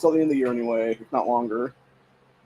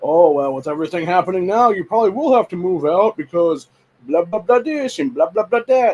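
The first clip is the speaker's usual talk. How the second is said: higher-pitched mocking imitation of sister; mock bitching; 4 semitones higher, 3 dB louder than usual. Sister telling him he will have to move out, mock bitching. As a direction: nag in a raised, mocking singsong. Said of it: "my sister keeps bitching about me".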